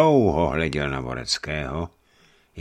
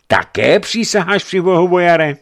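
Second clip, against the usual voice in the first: very high, loud questioning challenge; challenging question; 11 dB louder than usual. A narrator's reading. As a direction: throw the oath back as a question. Bishop challenges the boyar's oath.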